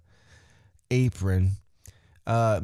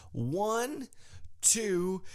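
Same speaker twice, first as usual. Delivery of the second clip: referee's count; 7 semitones higher, 6 dB quieter than usual. Retold or imitated.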